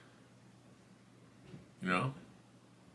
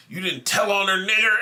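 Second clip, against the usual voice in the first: imitated indignant woman, higher and louder; indignant accusation; 7 semitones higher, 4 dB louder than usual. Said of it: "looked at me like".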